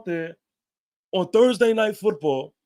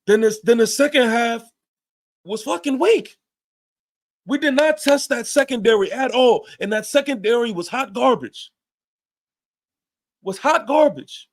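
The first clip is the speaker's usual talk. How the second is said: angry rant; 5 semitones higher than usual. Real, not acted. Fan ranting to his viewers about a weak second half, angry.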